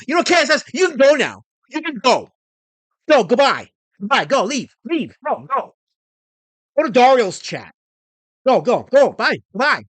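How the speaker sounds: high, loud shooing away; angry dismissal. Acted. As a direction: send them packing in repeated bursts. Mock quarrel, dismissing a chatter.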